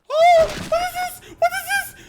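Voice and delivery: very high, loud startled yelp; startled shriek. Real, not acted. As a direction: shriek it in sudden fright. Startled by noise in game.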